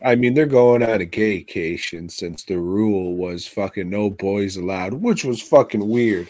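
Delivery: ordinary talk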